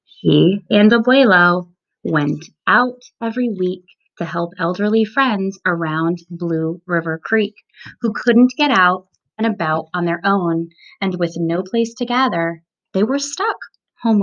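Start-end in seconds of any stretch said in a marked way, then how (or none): none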